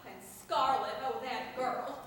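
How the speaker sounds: usual speaking voice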